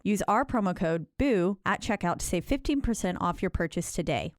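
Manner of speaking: polished, upbeat sponsor read; scripted promotional delivery. Acted. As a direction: read it like a podcast sponsor spot.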